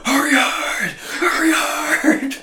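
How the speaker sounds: very high yelled Hurry hard, slowing; curling skip yelling. Retold or imitated. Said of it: "start screaming".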